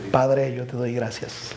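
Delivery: oración lenta y baja, recogida; prayer. Acted. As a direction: ora con reverencia y calma. Oración final. (oración pastoral lenta y reverente)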